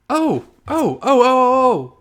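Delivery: high, loud gleeful squeals; excited squealing. Real, not acted. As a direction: squeal with delight. Excited squeal over new icon.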